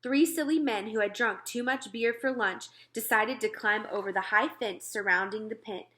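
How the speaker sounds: ordinary delivery, nothing marked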